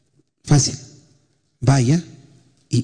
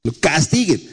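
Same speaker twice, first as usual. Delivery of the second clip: mock harsh parent; 7 semitones higher than usual. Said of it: "algunos papás deben decir".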